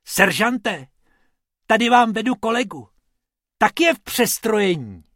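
high, excited little rabbit voice; elated, high-pitched announcing; jubilant. A narrator's reading. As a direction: call out triumphantly to the officer. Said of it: "rozjásaný Bobkův hlas".

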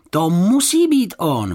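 high, loud drawn-out cry; exclaiming. A narrator's reading. Boy realising it is him, exclaiming. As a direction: cry out in excited certainty. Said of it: "vykřikl Jonatán".

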